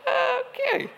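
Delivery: high, squeaky laughing okay; cracking up, can't stop; helpless laugh. Real, not acted. Preacher cracking up at a man in the crowd, high laugh. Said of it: "He doesn't even know".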